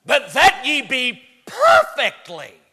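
loud, high, slow preaching; emphatic scripture reading. Acted. Preacher reading the text to the church, slow emphatic shout. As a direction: punch out the verse slowly and loudly.

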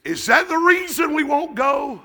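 high, slow shouted challenge; preaching shout. Real, not acted. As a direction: fire a rhetorical question at the congregation.

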